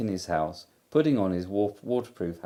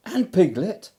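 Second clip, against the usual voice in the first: higher, louder eager call; excited; 11 semitones higher, 6 dB louder than usual. A narrator's reading. Christopher Robin spotting Piglet too, excited. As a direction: say it with rising delight. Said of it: "said Christopher Robin excitedly".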